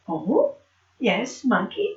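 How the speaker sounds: normal talking voice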